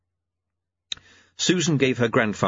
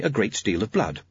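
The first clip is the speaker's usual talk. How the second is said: ordinary delivery, nothing marked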